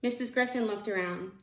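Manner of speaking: plain reading voice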